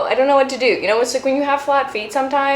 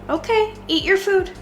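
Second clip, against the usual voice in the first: much higher voice for the dog; pet talk; 9 semitones higher than usual. Real, not acted. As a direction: coax the puppy to eat. Feeding the dog.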